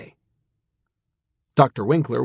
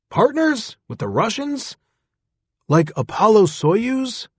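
much higher, incredulous questions; old astronaut hearing of partners with the Russians, amazed; astonished old man; 8 semitones higher than usual. Acted. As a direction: voice jumping up in disbelief.

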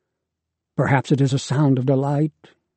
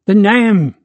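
loud high call back; shouting; 7 semitones higher, 7 dB louder than usual. Acted. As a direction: yell it over your shoulder. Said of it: "he shouts back".

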